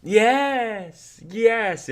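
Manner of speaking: high, muffled call through a door. Acted. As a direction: answer as if muffled behind a closed door. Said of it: "in a muffled voice behind the door".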